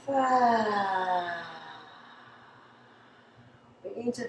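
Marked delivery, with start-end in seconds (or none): 0.1-1.4 long sighing "ah" on the out-breath; slow falling relaxation sigh; audible exhale. Shown as a demonstration. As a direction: let the breath fall out in an ah. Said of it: "exhale through the mouth".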